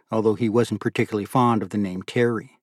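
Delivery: plain reading voice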